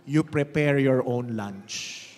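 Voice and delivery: slow, low, loud emphatic words; slow pointed line. Shown as a demonstration. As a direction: tell your neighbor the moral. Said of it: "sabihin mo sa kanya".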